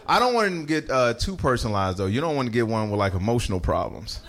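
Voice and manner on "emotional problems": ordinary talk, nothing marked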